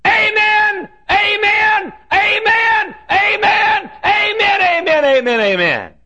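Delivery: very high shouts, tumbling down at the end; hollered amens. Retold or imitated. Lone listener hollering from under the balcony, high hollering. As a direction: holler it from the back in a piercing high voice. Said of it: "And he'd holler".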